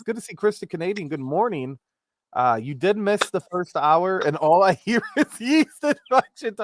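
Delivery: usual speaking voice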